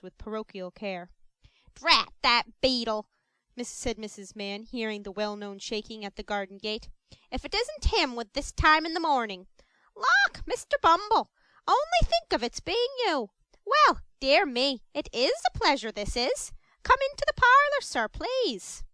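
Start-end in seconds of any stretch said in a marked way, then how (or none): 1.7-3.1 loud, annoyed outburst at the gate; irritated exclamation. Acted. Mrs Mann cursing the beadle at her gate, loud and annoyed. 7.3-18.8 high, loud, fawning woman's voice; gushing delight. Acted. Mrs Mann fawning over Mr Bumble at the gate, high and gushing. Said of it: "the exclamations of delight".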